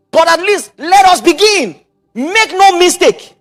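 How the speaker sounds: slow, high, loud emphatic preaching; preaching at full force. Real, not acted. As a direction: drive each word home from the pulpit. Preaching build.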